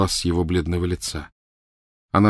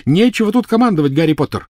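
shrieking; 10 semitones higher, 6 dB louder than usual. Acted. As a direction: shriek it back at him. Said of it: "крикнула она".

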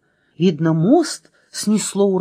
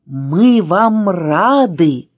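very slow, louder echoed greeting; echoing welcome; 5 dB louder, much slower than usual. A narrator's reading. Letter Ы echoing the welcome, louder and very drawn-out. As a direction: stretch every word, louder, like an echo. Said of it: "вторила буква".

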